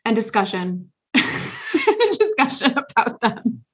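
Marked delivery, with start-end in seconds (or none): 1.0-3.6 laughing. Real, not acted. Laughs at own show pitch.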